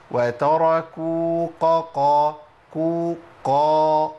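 slow loud chant, وتركوك ending in heavy q before قا; drawn-out faulty recitation, k turned to q in وتركوك; kaf thickened early. Shown as a demonstration. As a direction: recite وتركوك قا wrongly, readying the qaf on the kaf. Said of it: "أستعد لتفخيم القاف من عند الكاف".

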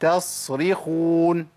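يستصرخون very slow, clear س then heavy ص; يستصرخون each letter separated; slow careful model. Shown as a demonstration. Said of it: "على فصل المخرج". (يستصرخون ببطء مع فصل المخرج)

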